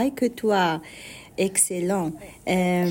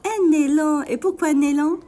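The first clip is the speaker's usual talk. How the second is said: delighted echo; 7 semitones higher, 6 dB louder than usual. Real, not acted. Host delighted echo to child.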